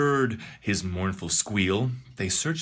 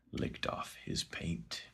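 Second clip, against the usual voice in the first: slow, much softer whispered ending; 6 semitones lower, 13 dB quieter than usual. Acted. Narrator ends the dark tale in a slow whisper. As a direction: hush the last line.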